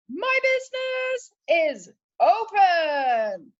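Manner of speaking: high, slow, proud proclamation; grand announcement. Retold or imitated. Business owner proudly opening to a fanfare. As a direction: announce it like a herald. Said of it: "It was a fanfare".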